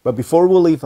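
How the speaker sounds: booming sign-off call to viewers; loud announcer outro. Acted. Teacher signing off to viewers, booming announcer call. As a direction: belt it like a cartoon announcer.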